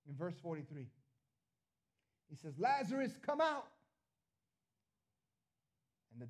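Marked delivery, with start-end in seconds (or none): 2.6-3.7 high, loud commanding shout; loud call. Acted. Preacher as Jesus calling Lazarus from the tomb, loud shout. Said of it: "Jesus called out in a loud voice".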